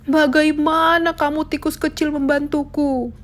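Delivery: the lion mocking the little mouse's offer; amused scoffing lion. A narrator's reading. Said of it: "Singa merasa tertawa geli". (singa mengejek dengan geli)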